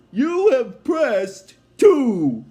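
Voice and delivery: slow, loud machine announcement; automated voice. Retold or imitated. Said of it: "the automated voice says".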